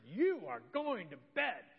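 a fed-up dad ending the discussion; stern exasperated order. Retold or imitated. Father to his nine-year-old, slow exasperated command. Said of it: "I lost it".

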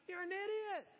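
a hint of disgusted contempt, thought not said; mock scorn. Thinking at yelling soccer dad.

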